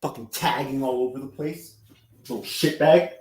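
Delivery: muttering. Real, not acted. Muttering insults about Jules.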